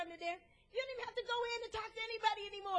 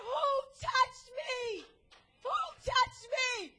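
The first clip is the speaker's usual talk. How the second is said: loud, slow, higher cry; Jesus calling out; 6 semitones higher, 6 dB louder, slower than usual. Acted. Jesus calling out to the crowd, loud and slow. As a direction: call out slowly over the crowd.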